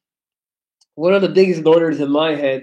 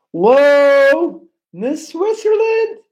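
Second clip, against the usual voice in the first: high, loud startled whoa, then a stretched question; real surprise on reading a viewer's name; surprised; 8 semitones higher, 4 dB louder, much slower than usual. Real, not acted. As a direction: genuinely caught off guard by a donation.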